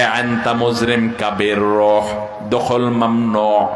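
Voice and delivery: slow, low Gulf Arabic pronunciation; quoting Gulf Arabic words slowly; dialect Arabic quote. Retold or imitated. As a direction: read out the Dubai Arabic words slowly and deliberately. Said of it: "Dubai থেকে তারা জানিয়ে দিয়েছে".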